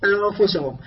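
ordinary delivery

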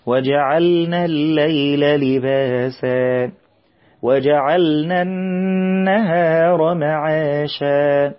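very slow chanted recitation, doubled meem held; Quran recitation. Shown as a demonstration. Teacher models the verse for children, slow chanted recitation. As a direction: chant it slowly as in tajweed class.

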